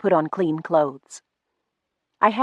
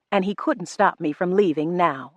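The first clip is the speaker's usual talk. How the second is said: usual speaking voice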